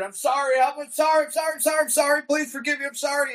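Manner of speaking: hammed-up pleading like a stuck record; broken-record apology. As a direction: parody someone begging over and over. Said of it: "almost sound like a broken record".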